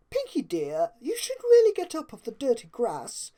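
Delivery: gently scolding fashionista voice; chiding. Acted. Rarity chiding Pinkie on the grass, prim scolding. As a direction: tut at her like a fussy aunt. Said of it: "Rarity chided her".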